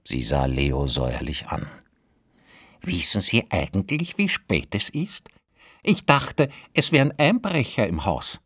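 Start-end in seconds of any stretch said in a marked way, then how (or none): 2.8-8.4 put-on high, indignant old woman; landlady voice. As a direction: scold like a sour landlady. Landlady scolds late lodger.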